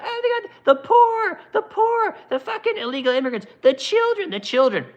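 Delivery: very high, wailing crybaby imitation; mock whining. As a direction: wail it in a hammed-up falsetto. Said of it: "When the people are whining about".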